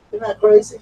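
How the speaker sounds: stunned by the shredded pillow; disbelief. Real, not acted. Dog tore up the pillow.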